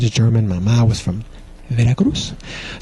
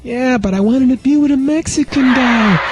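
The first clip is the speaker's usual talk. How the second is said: put-on much higher, whiny girlfriend voice; disappointed girlfriend; 12 semitones higher than usual. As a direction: pout it out. Girlfriend complains.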